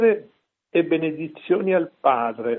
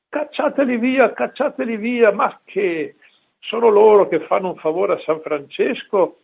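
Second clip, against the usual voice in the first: much higher, louder, fiery rebuke; indignant outburst; 5 semitones higher, 3 dB louder than usual. Retold or imitated. Padre Pio scolding the definitor, high indignant outburst. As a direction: throw them out, cry it with indignation.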